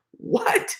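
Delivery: very high, airy squeak of disbelief; mock disbelief. Pranked man reliving his shock, mock disbelief. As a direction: let it jump up into a squeak.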